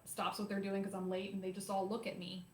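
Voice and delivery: ordinary talk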